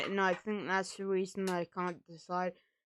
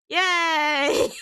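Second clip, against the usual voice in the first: loud ironic yay, higher; sarcastic cheer; 8 semitones higher, 8 dB louder than usual. Real, not acted. Sarcastic cheer about dysmorphia.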